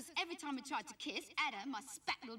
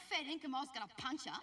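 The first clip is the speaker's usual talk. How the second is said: ordinary talk, nothing marked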